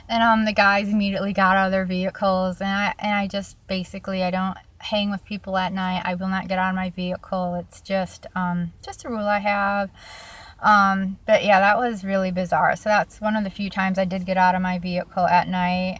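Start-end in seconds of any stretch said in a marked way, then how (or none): none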